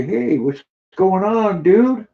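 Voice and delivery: high, drawn-out voice for the pet; slow high-pitched pet greeting; speaking for the pet. Pretend. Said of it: "say hi to Redneck".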